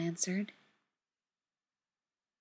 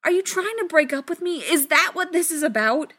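higher and louder, accusing; alarmed accusation; 8 semitones higher, 6 dB louder than usual. Acted. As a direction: demand an answer, rattled. Accusing partner of breakup.

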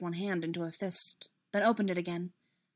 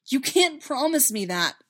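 loud, raised-pitch objection; distraught protest; 7 semitones higher, 9 dB louder than usual. A narrator's reading. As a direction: push back hard, upset. Distraught character rejects a promise.

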